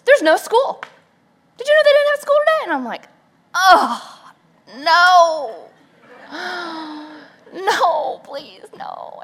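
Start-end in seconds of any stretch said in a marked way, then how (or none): 0.1-2.6 high, loud and rushed with glee; gleeful husband babbling fast; excited husband. Retold or imitated. Husband excitedly announces snow day. 3.5-8.2 loud, high mock-horrified wail with a gasp; hammed-up dismay; mock horror. Mother reacts to snow day. 8.2-9.2 breathless whispered begging; mock whispered despair; whispered plea. Pleading aside.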